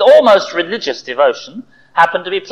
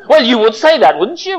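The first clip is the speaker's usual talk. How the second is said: louder, scornful jab; sharp retort; 8 semitones higher, 3 dB louder than usual. Acted. Arsenal fan retorting to his rival friend, scornful. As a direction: throw it back at him. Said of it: "retorted John".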